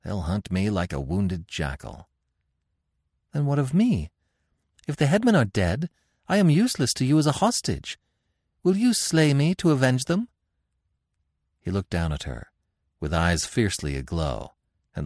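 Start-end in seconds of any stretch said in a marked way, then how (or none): 3.3-10.3 high-pitched, anxious queen demanding answers; high, challenging royal captive. A narrator's reading. Narrator voices the captive queen.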